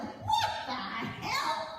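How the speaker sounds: high, slow, laughing disbelief; stunned, laughing what the hell; incredulous. Retold or imitated. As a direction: stretch it out in amused shock. Reacting to a writhing woman.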